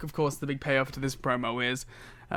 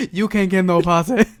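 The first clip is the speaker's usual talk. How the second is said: loud mock Dusty retort, much louder; Dusty impression; 5 semitones higher, 10 dB louder than usual. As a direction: bellow it like the old wrestler. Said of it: "he just looked at him and said".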